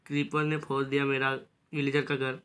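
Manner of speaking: ordinary talk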